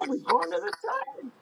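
high, bright giddy yes; so excited. Retold or imitated. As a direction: gush it like a thrilled kid. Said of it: "I was so excited".

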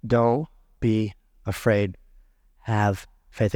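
calm a frightened father gently; tender reassurance. Acted. Preacher quotes Jesus again.

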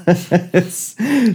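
breaks into a high giggle; laughing mid-word. Real, not acted. Host reading the ad to co-host, giggling. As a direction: cracks up reading the ad aloud.

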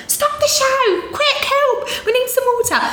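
put-on high, urgent Essex accent call. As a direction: yell for help in broad Essex. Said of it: "my Essex accent".

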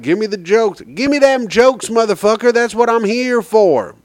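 high-pitched mock heckler; demanding audience member. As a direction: imitate an impatient crowd demanding laughs. Voices demanding, impatient audience.

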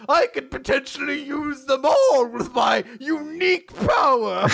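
high, loud, hammy villain monologue; devil's grand boast. Retold or imitated. Host voicing the devil boasting of magic, theatrical villain. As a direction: proclaim it grandly, punching big words. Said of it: "he's like".

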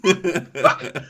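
laughing hard. Real, not acted. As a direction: crack up watching a friend suffer. Laughing hard at friend's hiccups.

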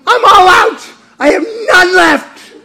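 loud, high mock despair, laughing; exasperated wife. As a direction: ham up the worn-out wife. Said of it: "And she said".

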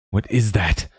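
a touch of alarm, hissed at a companion. A narrator's reading. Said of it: "Ingolfur hissed".

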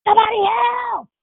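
high, loud cry for rescue; yell for help. Acted. Showing callers how to cry for help, loud yell. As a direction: yell out as if trapped in a fire. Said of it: "we don't yell out for help".

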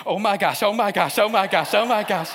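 loud, rapid mock panic, repeated over and over; freaking out. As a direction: imitate a friend losing her mind under stress. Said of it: "she starts freaking out".

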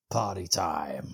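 low, slow action hero one-liner; deep, stretched-out imitation of a film hero; action film catchphrase. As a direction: drawl it out low, like a movie catchphrase. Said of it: "the best delivery of simply saying".

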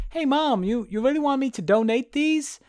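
high-pitched mock-boyish question; acted young Andy. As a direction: play a son calling to his mum, pitched high. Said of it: "looks at the note and goes".

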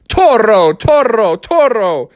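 high, punched bullfighter taunt; matador call. Acted. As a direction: summon the bull to charge. Bear taunts bull as matador.

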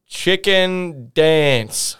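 drawn-out, very slow command. Retold or imitated. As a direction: Chicken dance, stretched out slowly. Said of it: "he just goes".

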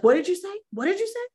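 sharp, high retort repeated twice; angry retort. Retold or imitated. Stranger angrily challenges the coach's strange offer, sharp and high. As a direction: imitate an offended stranger snapping back. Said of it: "She's angry".

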